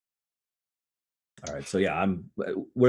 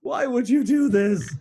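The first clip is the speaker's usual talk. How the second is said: pitched-up disbelieving whine; high, slow wounded protest; 4 dB louder, slower than usual. Real, not acted. Player protests at the friend who stabbed his character, high and slow. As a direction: wail it out in mock betrayal.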